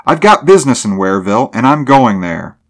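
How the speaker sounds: thrusting retort. A narrator's reading. Driver insists to gatekeeper he is going through, defiant retort. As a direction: press on with defiance. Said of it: "Mulvaney thrust at him".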